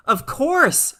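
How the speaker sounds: high, loud, firm "of course"; a hint of a brisk, impatient mother; emphatic mother. Mother's sharp reply to Lisa.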